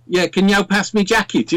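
Midlands accent. Shown as a demonstration. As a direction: put on a broad Midlands accent. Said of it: "they'd have this accent".

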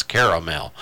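"caramel" in three syllables, KAIR-uh-mel; corrected pronunciation. Shown as a demonstration. Host conceding the three-syllable pronunciation of "caramel" is right. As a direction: say "caramel" as spelled, care-a-mel. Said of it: "apparently he's right".

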